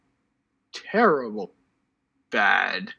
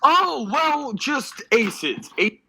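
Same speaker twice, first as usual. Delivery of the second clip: gushing; 8 semitones higher, slower than usual. Real, not acted. Gushing over chat compliment.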